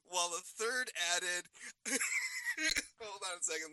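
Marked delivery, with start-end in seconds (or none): 1.5-2.9 helpless high-pitched laughter; high giggle fit. Real, not acted. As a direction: break up laughing, high and long. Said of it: "let me compose myself".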